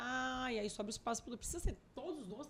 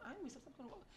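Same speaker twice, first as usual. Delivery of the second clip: whiny daughter; 4 semitones higher, 11 dB quieter, much faster than usual. Retold or imitated. Daughter whining refusal.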